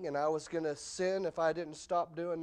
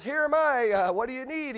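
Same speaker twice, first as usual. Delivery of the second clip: put-on eager boy; 8 semitones higher, 6 dB louder than usual. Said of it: "and he goes".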